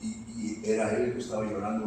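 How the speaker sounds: usual speaking voice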